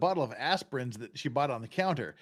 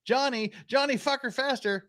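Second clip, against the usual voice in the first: mock yell; 9 semitones higher than usual. Mother calling her boy from outdoors, mock yell. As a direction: sing out the name as if from a doorway. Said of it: "yells for him".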